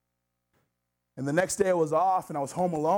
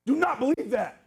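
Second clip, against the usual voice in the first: high-pitched, driving sermon cry; fervent preaching; 10 semitones higher, 4 dB louder than usual. Real, not acted. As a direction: build the conviction, hammering short phrases home. Preaching at full force.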